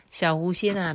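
usual speaking voice

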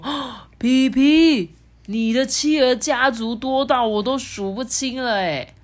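gasp, then high drawn-out wonder; amazed. A narrator's reading. Monkey marvels at the penguin family, astonished. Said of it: "惊奇地说".

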